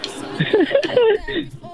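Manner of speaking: high-pitched burst of laughter. Real, not acted. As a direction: cackle high.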